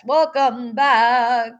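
slow, high, sung-out welcome; singsong greeting. Real, not acted. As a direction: stretch welcome back out like a song. Greets returning chat members in singsong.

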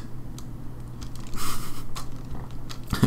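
breathy stifled laugh; whispered laugh. Real, not acted. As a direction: laugh under your breath. Laughs after whispered joke.

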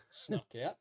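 ordinary talk

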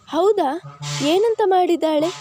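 a mother suddenly lit up with hope; eager relief. A narrator's reading. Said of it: "ಕಣ್ಣುಗಳಲ್ಲಿ ಸಾವಿರ ಮಿಂಚು". (ಉತ್ಸಾಹದ ಕಾತರದ ಪ್ರಶ್ನೆ)

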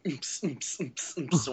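soft mouth-made techno thump; imitated rave beat. Describing being flung about by the chair, imitated rave thump. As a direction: mimic a club beat under the breath. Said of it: "felt like I was at a rave".

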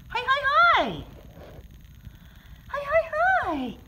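squeaky, bright-eyed hello to a cat; high excited pet greeting. Real, not acted.

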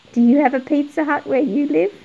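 normal talking voice

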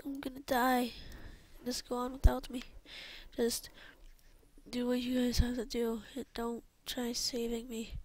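soft, weak last words; acted dying plea. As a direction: fade like someone dying, quietly. Dying character tells friends to leave.

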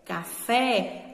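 "café" with FÉ held long; stress on final syllable. Shown as a demonstration. Teacher repeating the example for pupils, FÉ stressed in "café". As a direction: dwell on the last syllable, FÉ, of "café". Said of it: "que eu pronunciei com mais veemência".

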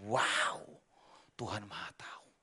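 whispered in wonder; awed whisper. Acted. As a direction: breathe it in awe. Awed aside about God.

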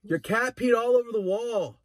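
put-on high, slow little-kid voice; fake-innocent kid. Little boy framing the cat to Robert, high innocent voice. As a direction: play it sweet and innocent. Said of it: "acting innocent to Robert".

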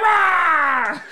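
high growly roar mimicking a furious player; hammed-up "rah"; mock angry roar. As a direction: roar like a tantrum, mockingly. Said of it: "He's like".

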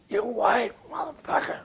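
imitating Miles, high and rasped; a hint of [name] cursing at the producer; raspy Miles voice. Miles cursing the producer, imitated high and rasped.

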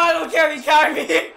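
ordinary delivery

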